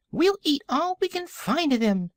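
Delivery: very high, squeaky piglet; teeny high-pitched creature voice; tiny piglet voice. A narrator's reading. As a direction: chirp it as a tiny pig. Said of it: "said another".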